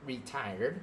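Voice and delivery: ordinary delivery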